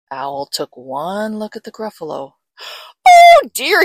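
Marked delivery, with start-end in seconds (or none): 3.1-3.8 very high, loud hooting cry; gasp, then wail it in fright; alarmed owl. Acted. Owl sees the Gruffalo, high alarmed cry.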